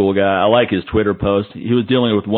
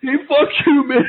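mock angry outburst. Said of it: "just visualize yourself with that, that angry Harley face".

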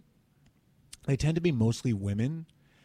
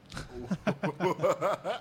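laugh; 7 semitones higher than usual. Real, not acted. Laughing at Whispering Wes comment.

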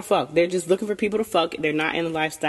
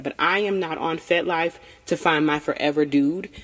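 ordinary delivery

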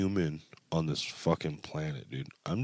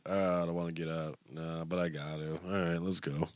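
groggy self-talk; 8 dB quieter, faster than usual. Retold or imitated. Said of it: "I'm like".

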